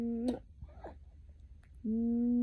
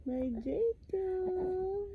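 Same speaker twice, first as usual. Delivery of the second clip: slow and drawn out, a touch higher; drawn-out coo; 4 semitones higher, slower than usual. Real, not acted. Mother cooing her baby's name, slow drawn-out coo. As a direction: stretch the name lovingly.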